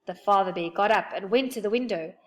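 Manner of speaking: ordinary delivery, nothing marked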